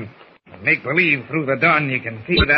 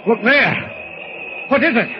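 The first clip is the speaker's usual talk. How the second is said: startled; 10 semitones higher than usual. Acted. Captain sees rescue coming.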